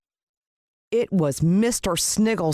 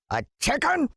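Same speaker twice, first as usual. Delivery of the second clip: high outraged shriek of disbelief; screaming; 11 semitones higher than usual. A narrator's reading. Greedy man screaming at his pet snake, outraged shriek. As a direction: shriek the word in a glutton's fury. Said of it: "screamed Mister Sniggles".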